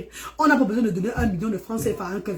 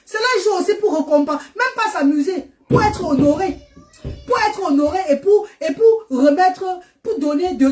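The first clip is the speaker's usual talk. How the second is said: raised, impassioned preaching; rousing sermon voice; 7 semitones higher than usual. Acted. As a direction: lift the crowd up, higher.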